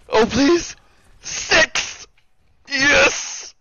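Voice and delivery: high, loud gleeful shouts; excited cheering. Real, not acted. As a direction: cheer the kills wildly. Multi-kill in game, cheering.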